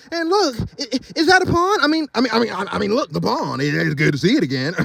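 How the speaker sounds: high, stammering excitement; excited stammer. Acted. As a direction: babble excitedly about the pond. Excited donkey voice continues.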